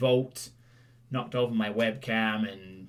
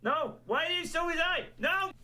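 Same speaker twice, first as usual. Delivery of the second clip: much higher squeaky mock repeat; 4 dB quieter than usual. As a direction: parrot the line in a silly squeak. Mock high-pitched repeat of clip line.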